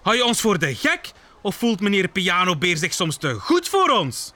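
angry shouting. Acted. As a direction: scold Bear, climbing high at the end. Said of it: "roepen ze boos". (boos en verontwaardigd)

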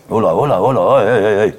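rapid run of mild whoa-sounds; lightly acted, startled tutting; taken aback. A narrator's reading. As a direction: rein in a greedy child. Parent checks greedily gulping child.

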